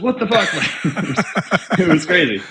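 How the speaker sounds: high, laughing what the fuck; can't believe how easy that was, laughing; laughing disbelief. Real, not acted. Telling the host the client said yes to doubling, laughing in disbelief.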